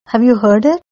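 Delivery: ordinary delivery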